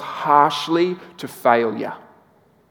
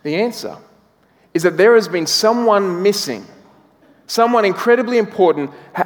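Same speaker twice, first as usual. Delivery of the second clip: ordinary delivery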